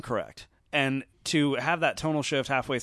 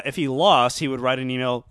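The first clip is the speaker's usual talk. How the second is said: ordinary delivery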